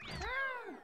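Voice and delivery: high-pitched drawn-out "ah"; high cry. Acted. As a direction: let out a playful high cry. Follows the hammed-up dinner holler.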